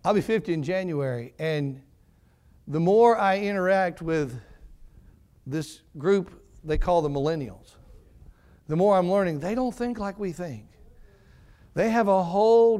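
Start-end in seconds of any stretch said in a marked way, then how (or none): none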